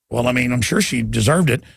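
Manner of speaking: low, deadpan sarcasm; mock agreement, pitched well down; sarcastic. Real, not acted. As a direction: drop the pitch for a dry, sarcastic aside. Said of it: "I'm being sarcastic".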